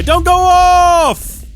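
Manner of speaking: a long, high, loud wail of "don't"; pleading cry. Real, not acted. Player begging the screen not to switch off, loud wail.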